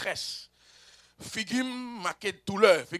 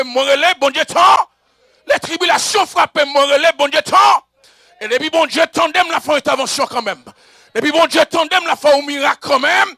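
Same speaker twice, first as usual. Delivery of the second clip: full-force preaching; 8 semitones higher, 17 dB louder than usual. Acted. Preacher promising the congregation God hears their cries, full-force preaching. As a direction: preach it in rising, repeated cries.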